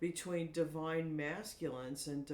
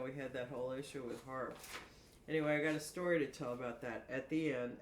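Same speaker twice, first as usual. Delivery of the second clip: ordinary talk, nothing marked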